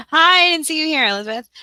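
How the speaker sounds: high, loud fake-surprised hello; mock surprise. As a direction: act like you just spotted her. Mock surprised greeting to viewer.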